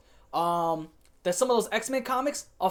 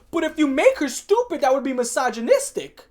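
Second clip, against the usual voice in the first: high, loud caricature of a worried executive; mock outrage; 6 semitones higher, 7 dB louder than usual. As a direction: exaggerate the objection mockingly. Mocking corporate worry about offending.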